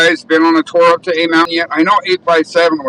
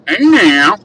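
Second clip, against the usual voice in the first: playful, louder Texas twang; Texas accent; 5 semitones higher, 6 dB louder than usual. Acted. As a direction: stretch it into a Texas drawl. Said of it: "your Texas accent".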